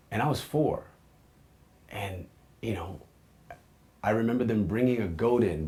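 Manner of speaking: ordinary delivery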